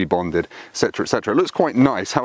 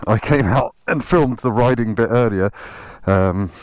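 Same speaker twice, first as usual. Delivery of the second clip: normal talking voice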